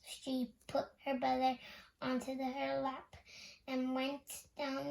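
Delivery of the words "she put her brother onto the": ordinary delivery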